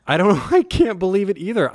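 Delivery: incredulous, high, through a laugh; laughing disbelief. Real, not acted. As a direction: laugh in amazement as you speak. Amazed banter about the magical sheets.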